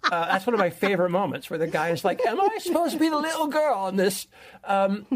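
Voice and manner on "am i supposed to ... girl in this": incredulous player. Retold or imitated. Quoting a player reading the book.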